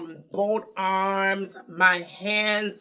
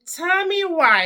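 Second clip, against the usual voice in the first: exasperated cry; 10 semitones higher, 5 dB louder than usual. Real, not acted.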